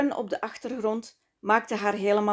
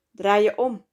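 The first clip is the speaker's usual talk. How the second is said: louder, eager order to turn around; aroused command; 6 dB louder than usual. A narrator's reading. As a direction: push the words out with excitement. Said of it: "sprak June opgewonden". (opgewonden bevel)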